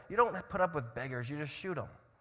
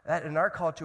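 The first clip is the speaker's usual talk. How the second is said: ordinary talk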